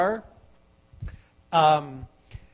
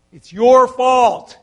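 slow, loud, pressed; slow loud preacher emphasis; emphatic; 3 semitones higher, 9 dB louder, slower than usual. Real, not acted. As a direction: hammer the blame home. Preacher driving point home.

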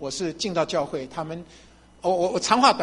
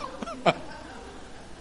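silent laugh; 9 dB quieter than usual. Real, not acted. Laughs, wife agreed.

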